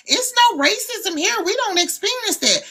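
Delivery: high-pitched mimicry of naive deniers; mock denial. Mimicking people who deny racism.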